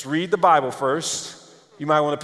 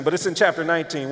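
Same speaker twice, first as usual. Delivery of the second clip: ordinary talk, nothing marked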